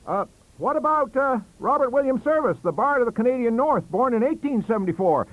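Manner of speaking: high, loud eager question; eager cry. Acted. Bissell defending Le Pan, high and eager. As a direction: jump in with Service. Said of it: "he cried".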